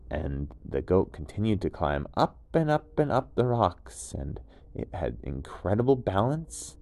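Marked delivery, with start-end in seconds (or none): none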